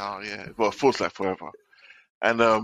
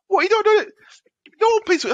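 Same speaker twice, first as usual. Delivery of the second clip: squeaky high mock voice, louder; mocking colleagues; 6 dB louder than usual. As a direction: tease him in a very high piping voice. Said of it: "they're busting".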